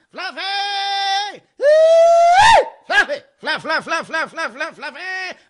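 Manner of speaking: high, loud hog call of the name. Retold or imitated. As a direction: holler across the parking lot like calling hogs. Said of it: "Is he hog calling me?".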